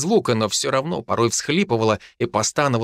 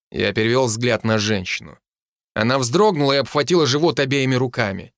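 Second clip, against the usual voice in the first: plain reading voice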